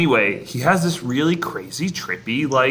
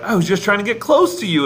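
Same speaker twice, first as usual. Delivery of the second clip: imitating Goofy; 8 semitones higher, faster than usual. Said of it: "Goofy's like".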